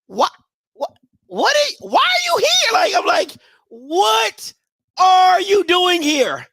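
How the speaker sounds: mother baffled by a stranger at her door, shrill disbelief; incredulous. Retold or imitated. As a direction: act stunned someone would say that. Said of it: "I'm looking at her like".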